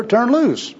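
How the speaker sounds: "turn loose" high and falling, as a command; fervent exhortation. Acted. Worker exhorts bum to "turn loose", high and falling, fervently. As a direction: urge "turn loose" from a high pitch down to a firm end.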